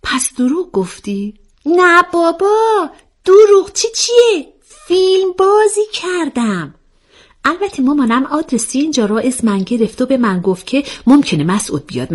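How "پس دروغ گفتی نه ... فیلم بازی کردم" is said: high, exclamatory character voice; animated young storyteller character. Acted. (صدای نازک و نمایشی)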